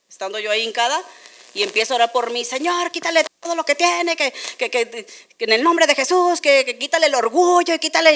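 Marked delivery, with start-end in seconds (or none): 2.4-8.1 higher, fervent intercession voice, quite a bit louder by the end; loud praying woman. Retold or imitated. Stranger laying hands on her, praying loudly over her. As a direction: pray over her hard like a zealous prayer leader. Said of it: "empezó a orar recio por mí".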